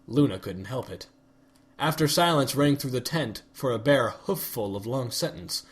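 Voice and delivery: plain reading voice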